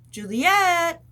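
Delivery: high, loud call across the garden. Retold or imitated. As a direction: call her name from afar. Calling sister to come out.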